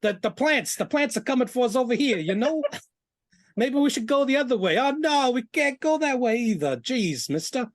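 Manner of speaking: put-on high, eager gee-whiz kid voice; gosh-darn innocent farm boy; wholesome 1950s kid. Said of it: "he's like".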